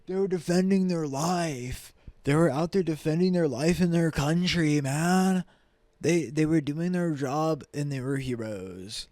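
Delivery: slow, high mock-defender voice; mocking imitation. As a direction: drag it out in a high, put-on voice. Mimics those defending the shooters.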